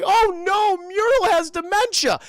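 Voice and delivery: high, loud frantic squeal; panicked dog. Acted. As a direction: wail it out like a frightened dog. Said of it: "he's just like".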